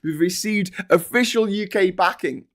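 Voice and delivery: normal talking voice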